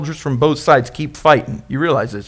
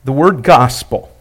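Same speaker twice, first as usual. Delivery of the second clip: usual speaking voice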